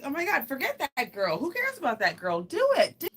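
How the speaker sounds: high, loud hype-friend voice; excited urging. Acted. To a best friend worried about another girl, excited urging. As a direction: egg them on, climbing to a squeal.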